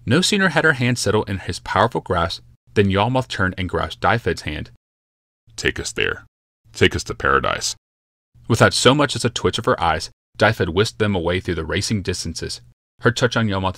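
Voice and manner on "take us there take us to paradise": lower, grave urgent command; a hushed, intense order; Yalmoth's command. Acted.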